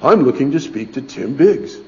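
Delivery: lightly voiced smooth stranger; a touch of the polished caller; a stranger on the phone asking for Tim, lightly pleasant. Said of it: "strangely pleasant voice".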